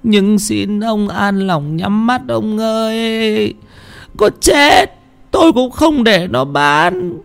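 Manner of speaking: put-on high, quavering tearful plea in an old woman's voice; sobbing old woman. Widow sobbing to her husband's ghost, high tearful old voice. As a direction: plead through tears, then vow firmly. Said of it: "Bà Bình nức nở".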